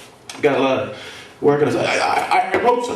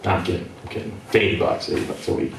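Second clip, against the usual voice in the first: lower, softer muttered aside; 7 semitones lower, 6 dB quieter, faster than usual. Real, not acted. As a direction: mumble it to yourself.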